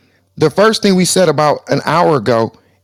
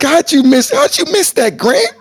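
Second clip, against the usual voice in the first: mock disbelief; 10 semitones higher than usual. Teasing Grant for missing it.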